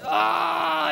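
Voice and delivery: a sudden high, strangled cry of pain. Retold or imitated. Beaten man cries out after the whack, high pained cry. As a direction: cry out as the bat lands in your gut.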